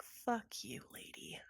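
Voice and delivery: hissed insult under the breath; whispered curse. Acted. Atticus whispering a curse at the tall lady.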